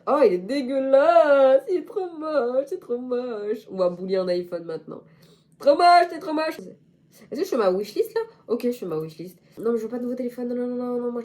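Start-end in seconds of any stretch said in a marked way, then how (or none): 0.1-3.7 loud, slow, whining disgust; slow whining mock disgust. Real, not acted. Disgust at ugly iPhone. 5.6-6.6 mock disgust. Real, not acted. Mocking the iPhone.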